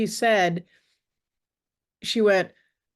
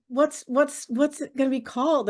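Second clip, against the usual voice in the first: higher, tumbling eager asking; eager question; 7 semitones higher than usual. Retold or imitated. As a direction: stumble over it with curiosity. Friend asks title eagerly.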